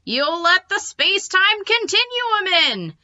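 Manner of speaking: high, slow, strange alarm; panicked alien. Acted.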